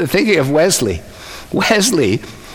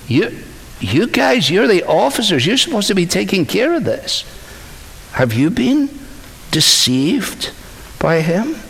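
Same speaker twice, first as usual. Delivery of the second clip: incredulous, higher-pitched scolding; mildly indignant telling-off, pitch raised; mock exasperation; 6 semitones higher than usual. Pharisees scolding the officers who came back empty-handed, exasperated.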